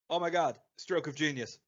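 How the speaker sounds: usual speaking voice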